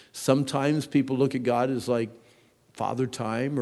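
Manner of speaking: ordinary talk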